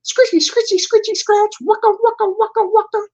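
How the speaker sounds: very high, squeaky record scratch sounds; squeaky high DJ scratch noises; record scratch imitation. Shown as a demonstration. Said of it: "when you take the record and you scratch".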